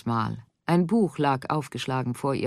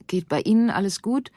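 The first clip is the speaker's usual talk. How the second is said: usual speaking voice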